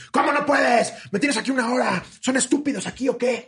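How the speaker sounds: high-pitched, outraged imitation of an old man; hammed-up irate complaint; angry customer yelling. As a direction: berate the clerk in rising indignation. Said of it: "Así, güey".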